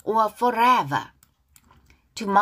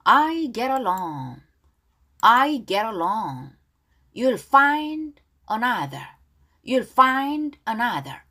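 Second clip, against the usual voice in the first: slow, careful repeat-after-me English; measured read-along for learners; pronunciation model; 2 semitones higher, 3 dB louder, slower than usual. Shown as a demonstration. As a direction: say each phrase slowly, then repeat it. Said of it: "자, 이 절 내용 발음 따라할게요".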